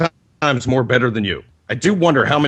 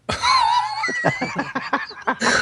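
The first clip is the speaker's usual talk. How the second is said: big laughing fit; 5 dB quieter than usual. Real, not acted. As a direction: crack up laughing hard. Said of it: "I'm laughing again".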